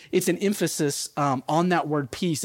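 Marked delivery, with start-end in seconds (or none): none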